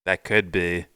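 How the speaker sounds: ordinary talk, nothing marked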